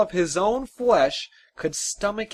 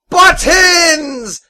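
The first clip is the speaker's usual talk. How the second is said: explosive high, very loud yell; volcanic eruption; 14 semitones higher, 14 dB louder than usual. A narrator's reading. Exasperated father corrects his forgetful son, erupting in a roar. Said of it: "his father erupted, his voice volcanic".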